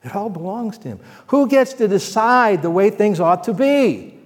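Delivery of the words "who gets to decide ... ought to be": louder, higher pulpit question; preaching at full force. Real, not acted. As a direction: hammer the question home. Sermon climax.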